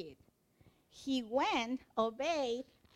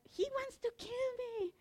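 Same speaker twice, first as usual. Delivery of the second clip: alarmed protest; 10 semitones higher, 5 dB quieter than usual. Acted. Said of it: "He's like".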